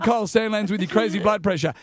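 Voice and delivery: ordinary delivery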